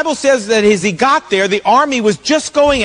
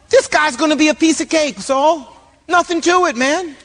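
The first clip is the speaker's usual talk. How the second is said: higher-pitched, breezy acted confidence; cocky nonchalance; 5 semitones higher than usual. David assuring Saul about Goliath, acted cocky ease. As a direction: toss it off as an easy job.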